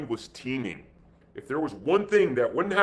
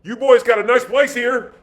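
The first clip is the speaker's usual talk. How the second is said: high, loud remark to the whole group; speaking aloud; 10 semitones higher, 7 dB louder than usual. A narrator's reading. Pilot addresses armed men after silent thoughts, speaking aloud. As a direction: pitch it up and out to the men. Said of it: "Aloud, he said".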